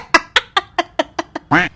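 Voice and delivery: high tickled giggle; tickled laughter. Acted. (गुदगुदी वाली हँसी)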